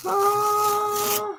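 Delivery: high, drawn-out "oh" of delight; real high-pitched amazement; awed. Real, not acted. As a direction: let out a high sigh of wonder. Awed reaction to the reveal.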